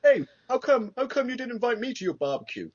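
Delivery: high-pitched mock whine; whining complaint. Acted Homer complaining to Flanders.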